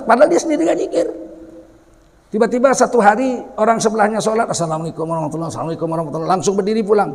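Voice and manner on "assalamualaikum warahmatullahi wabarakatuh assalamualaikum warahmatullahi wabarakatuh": gabbled very fast prayer greeting; mock hasty worshipper racing the words; rushed salam. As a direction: rattle through the salam in a hurry. Worshipper rushing the closing salam.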